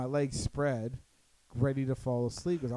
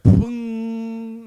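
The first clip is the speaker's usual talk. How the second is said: loud, high drawn-out boom; imitated crash boom; 9 semitones higher, 14 dB louder than usual. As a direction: mimic a crash with a big ringing boom. Imitating guitars falling.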